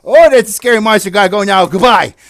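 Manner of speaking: loud panicky storybook wizard; alarmed wizard. Acted. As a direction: blurt it like a wizard fleeing. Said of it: "you know, the wizard, he said".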